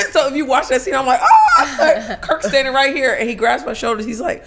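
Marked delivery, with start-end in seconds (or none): 1.2-2.2 loud, high mock scream; hammed-up freak-out shriek with laughter; panicked scream. Acting out her freak-out on set, panicked scream. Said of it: "I was freaking the fuck".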